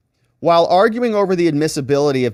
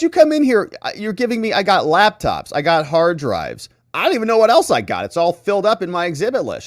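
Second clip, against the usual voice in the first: mock overwhelmed judge; 5 semitones higher than usual. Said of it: "She says".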